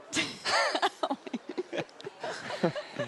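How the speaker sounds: airy high-pitched laugh. Real, not acted. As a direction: let out a breathy giggle. Laughs at himself.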